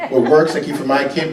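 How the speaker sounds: ordinary delivery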